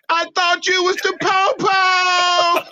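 high, slow laughing taunt; mocking laughter. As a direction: cackle it out, mocking the friend. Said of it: "David laughed from all the way".

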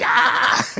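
sudden high yelp, hammed-up; mock startled sheep cry. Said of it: "they will be shouting".

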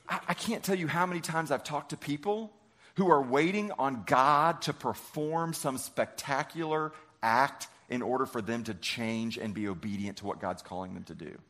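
ordinary delivery

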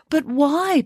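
high, drawn-out protest; pleading. A narrator's reading. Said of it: "pleaded Loretta".

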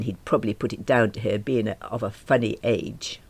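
ordinary delivery

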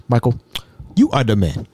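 low-pitched African man, imitated; African accent. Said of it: "Mbutu was an African".

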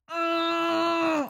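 grito ahogado contra la almohada; un grito de rabia, ahogado y largo; muffled scream. Real, not acted. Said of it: "ese es mi sonido". (gemido desesperado con la boca tapada)

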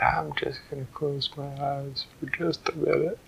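soft, drowsy murmur; sleepy girl drifting off; hushed, sleepy little voice; sleepy Goldilocks. A narrator's reading. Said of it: "And she said".

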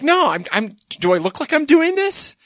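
high-pitched mock indignation; defensive denial. Hitler denying it to his dog, high defensive protest. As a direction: protest innocence, stammering and climbing in pitch. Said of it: "Hitler was like".

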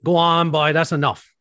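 exasperated brush-off, played for demonstration; annoyed. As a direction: shoo away someone who is getting on your nerves. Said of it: "you get on my nerves".

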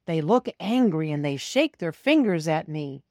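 usual speaking voice

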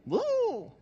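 high squeaky whoop; a sarcastic little cheer; mock woo. Preacher joking about church attendance plummeting, whooping.

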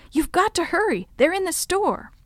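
high, urgent insistence; impatient. A narrator's reading. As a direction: press him to hurry. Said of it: "I said impatiently".

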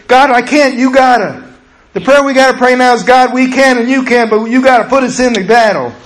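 high, loud, urgent preaching; rally the church to desperate prayer; full-force preaching. Acted.

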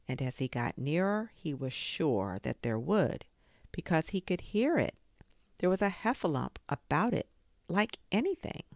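usual speaking voice

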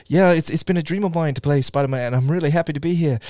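badly faked British accent. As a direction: do an amateur Tom Holland British accent. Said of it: "when he talks, he's like".

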